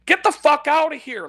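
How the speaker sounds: high, loud burst of disgust; exasperated shout. Retold or imitated. Fed up with gas pump TV, loud shout. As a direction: blow up at the screen like you're fed up. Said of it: "It's like".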